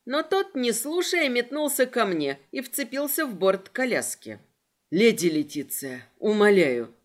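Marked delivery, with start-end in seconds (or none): 4.9-6.9 lightly voiced low young lieutenant, pleading. A narrator's reading. Sigurd to lady Letitia at the carriage, pleading. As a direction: implore her, louder on the last word. Sigurd begs her to stay.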